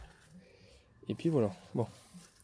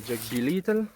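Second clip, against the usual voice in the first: put-on English accent. Gardener naming squash varieties for viewers, mock English accent. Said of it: "un accent anglais".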